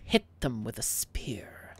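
low, hushed and slow; whispered order. Acted. Lord Lupo whispers to the bouncer to spear wizards. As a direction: lean in with a sinister command.

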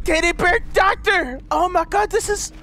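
high-pitched, amazed exclamation; thrilled discovery. Pretend. Player spotting a teddy bear doctor, thrilled. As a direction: cry out in delighted disbelief.

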